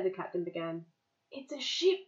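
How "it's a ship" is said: higher-pitched cry of discovery; mild shout. A narrator's reading. Wavy spotting the ship, mild shout. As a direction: announce the find in a raised pitch. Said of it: "Wavy shouted".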